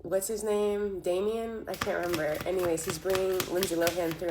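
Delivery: normal talking voice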